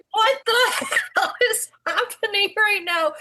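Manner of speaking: high, stunned cry of disbelief; reliving the moment she saw the fight; shocked disbelief. Retold or imitated. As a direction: recoil at the chaos on the field. Shocked at girl wrestling boy.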